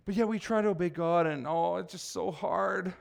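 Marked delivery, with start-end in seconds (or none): 1.5-2.9 slow, softer mock complaint; mock whining. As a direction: draw it out like a feeble moan. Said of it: "Stop whining".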